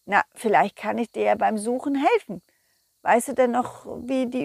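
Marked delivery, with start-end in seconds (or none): none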